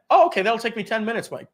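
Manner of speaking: eager affiliate. Pretend. Affiliate voiced agreeing to request.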